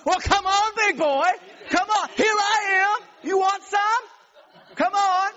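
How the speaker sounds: loud, high-pitched macho challenge; drawn-out, hollered dare; macho taunting. Retold or imitated. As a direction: goad a rival into a fight, shouting. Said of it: "the macho thing comes up".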